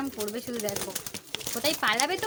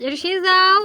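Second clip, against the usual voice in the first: high, loud call to the bird; loud coaxing call; 7 semitones higher, 12 dB louder, slower than usual. Real, not acted. Owner calling loudly to the pet bird to whistle.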